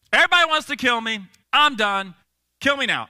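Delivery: exasperated, hammed-up plea; fed-up prayer. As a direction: complain up to God, done with it all. Acting out Elijah's despairing prayer.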